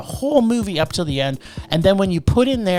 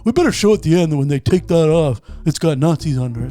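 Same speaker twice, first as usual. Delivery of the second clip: director voice; 3 semitones lower than usual. Acted. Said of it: "and it's like".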